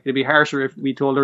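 normal talking voice

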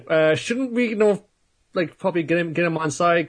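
a worried maiden pleading to recruit him; timid maiden. Retold or imitated. Retelling the maiden in a high, worried voice. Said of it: "And the maiden".

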